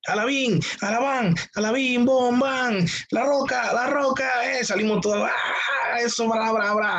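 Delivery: high, chanted football cheer; mock cheerleader. Pastor comparing famous preachers to cheerleaders, mock cheer chant. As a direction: lead a parody pep-squad chant, punching each beat. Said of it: "pongo una porrista de un equipo de fútbol".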